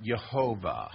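Yehovah said slowly in three syllables; three-syllable pronunciation. Shown as a demonstration. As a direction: demonstrate Yehovah as ye-HO-vah. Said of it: "That's three syllables".

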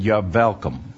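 put-on light Yiddish-style accent, V for W; old rabbi's accent turning welcome into velcome. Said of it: "the old rabbi".

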